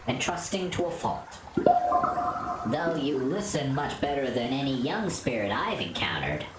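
low, calm ancient spirit; wise spirit voice. Acted.

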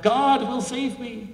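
slow, high, loud defiant yell; yelling back. Retold or imitated. Man on his chimney yells back at the helicopter crew, slow high yell. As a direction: yell it up stubbornly, drawn out. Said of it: "And he yells back".

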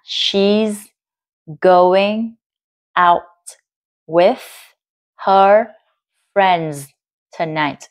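slow dictation with a gap after each word; separated, carefully sounded words; word-by-word answer. Shown as a demonstration. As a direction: read it out one word at a time. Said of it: "Và sau đây là đáp án".

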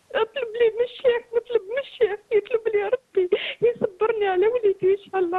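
tearful plea through sobs; speaking while weeping for her son; crying. Real, not acted. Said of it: "هاد البكا اللي راكي تبكي".

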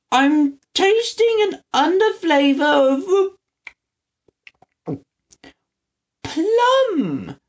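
put-on old man talking through food; high slow voice with mouth full. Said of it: "with his mouth mostly full".

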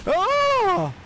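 sudden high scream; startled shriek. Real, not acted. Player shrieks as his fighter nearly falls off, alarmed. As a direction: shriek as if almost falling. Near death in game.

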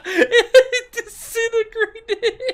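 high, loud, slow laughing words. Real, not acted. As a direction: crack up laughing. Laughing at overkill.

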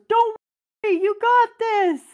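calling up to Luffy from the bottom, loud and high; screamed encouragement. Retold or imitated. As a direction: yell up the stairs to cheer him on. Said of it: "He screams up to him".

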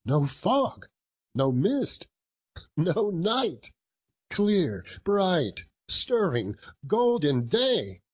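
exhilarated narration. A narrator's reading. Scrooge looks out on Christmas morning, exhilarated. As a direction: savour each word with rising delight.

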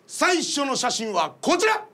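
usual speaking voice

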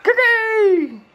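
high, loud, drawn-out Cookie; squealed cookie. Real, not acted. Parent modelling cookie for a toddler, high loud squeal.

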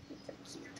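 barely voiced whisper repeat. Real, not acted. Whispering again so the pup stays asleep. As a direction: breathe it out soft and unvoiced. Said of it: "Oh, you're so cute".

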